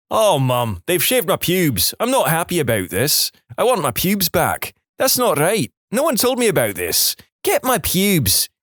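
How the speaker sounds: put-on high, indignant Scottish accent shout; outraged teen in a Scottish accent; broad Scottish accent. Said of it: "shouting in my broad Scottish accent".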